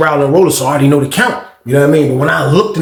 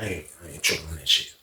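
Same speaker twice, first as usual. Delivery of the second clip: quick, low, soft mutter, much quieter; muttering to himself; 16 dB quieter, faster than usual. Retold or imitated. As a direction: brush it off under your breath. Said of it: "I was like".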